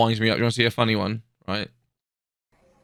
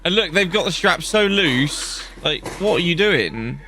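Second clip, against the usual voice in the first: usual speaking voice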